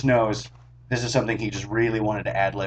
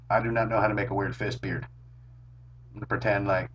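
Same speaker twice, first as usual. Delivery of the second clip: usual speaking voice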